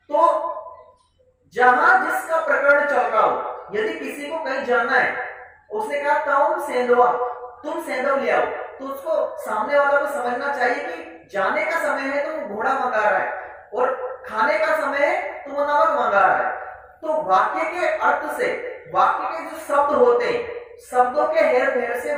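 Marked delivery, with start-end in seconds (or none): none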